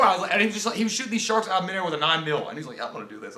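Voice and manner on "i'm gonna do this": low, soft tough-guy line; understated, low macho parody; mock action hero. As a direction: mutter it like a cheesy action star. Imitating action hero while shooting.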